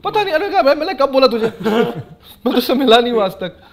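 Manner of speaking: high, loud, laughing disbelief; laughing outrage. Real, not acted. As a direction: burst out in amused indignation. Outraged at actor's comment.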